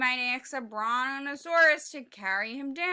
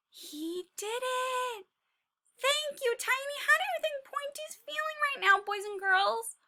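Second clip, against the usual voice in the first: excited; 13 semitones higher than usual. Acted. Excited teacher and Pointy.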